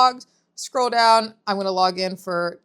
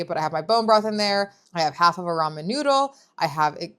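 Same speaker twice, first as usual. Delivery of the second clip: ordinary delivery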